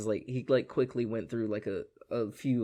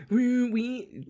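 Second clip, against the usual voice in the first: joyful wee; 12 semitones higher, 5 dB louder than usual. Retold or imitated. Character joyriding in circles.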